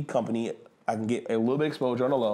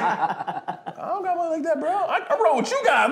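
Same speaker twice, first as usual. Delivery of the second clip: laughing protest; 6 dB louder than usual. Retold or imitated. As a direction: whine it through laughter.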